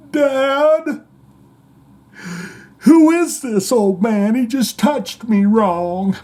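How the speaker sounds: high, slow whining wail; hammed-up blubbering child voice; mock crying son. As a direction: imitate a tearful kid calling for Dad. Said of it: "he started crying in front of Page".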